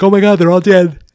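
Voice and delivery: high, loud mock horror; a sudden shocked realization; horrified gasp. Viewer realizes the friends are dead, high and loud.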